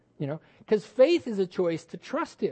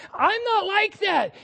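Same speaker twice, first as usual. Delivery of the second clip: protesting believer; 12 semitones higher, slower than usual. Retold or imitated. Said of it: "push you back to the Savior and say".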